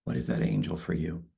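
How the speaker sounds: calm, spacious guided meditation. Acted. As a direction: ease them into stillness. Leads a closing meditation.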